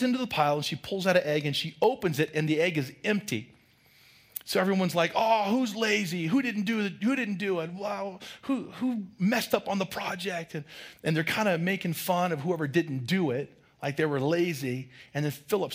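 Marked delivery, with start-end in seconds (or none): 5.1-10.5 mock taunting. Kids mocking the empty egg.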